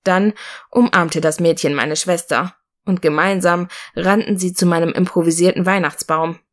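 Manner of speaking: ordinary delivery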